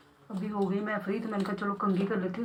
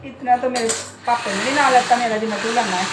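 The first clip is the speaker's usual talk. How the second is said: loud scolding; 7 dB louder than usual. Real, not acted. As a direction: tell the parrot off loudly. Scolding pet parrot.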